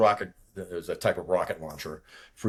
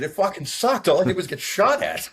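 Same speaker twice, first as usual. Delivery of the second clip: high, loud, rattled retort; worked-up; 11 semitones higher, 6 dB louder than usual. Retold or imitated. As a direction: re-enact your own overwrought complaint. Rattled pilot retelling his own reply.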